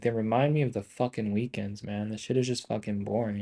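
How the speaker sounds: ordinary talk, nothing marked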